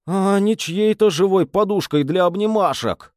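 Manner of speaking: high, pleading lament; despairing moan. A narrator's reading. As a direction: whine it out in despair. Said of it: "вырвался стон отчаяния".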